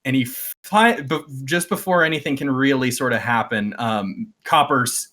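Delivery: ordinary delivery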